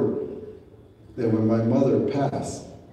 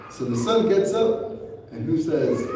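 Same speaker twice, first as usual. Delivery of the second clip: ordinary talk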